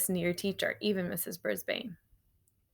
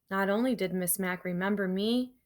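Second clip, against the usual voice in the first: usual speaking voice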